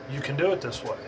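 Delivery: ordinary delivery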